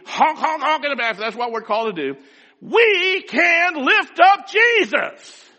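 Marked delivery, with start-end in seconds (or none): 2.6-5.5 preaching climax. Acted. Sermon climax, preacher exhorting congregation.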